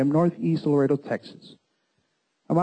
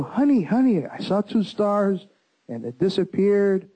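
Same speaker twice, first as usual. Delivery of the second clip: excitedly calling his wife, pitched higher; retold excitement; 5 semitones higher than usual. Husband tells wife of his sighting, retold excitement. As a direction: reenact breaking big news, voice lifted. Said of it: "I'm so excited".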